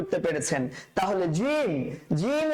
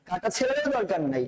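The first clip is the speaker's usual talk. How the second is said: usual speaking voice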